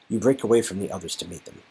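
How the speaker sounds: normal talking voice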